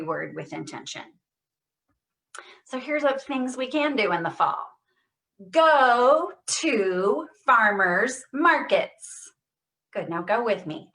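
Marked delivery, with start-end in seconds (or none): 5.5-9.3 slow, loud, every sound exaggerated; over-exaggerated stress. Shown as a demonstration. Therapist leading a Parkinson's voice drill, exaggerated stress. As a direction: stretch and punch each word. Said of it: "Every sound, over exaggerate".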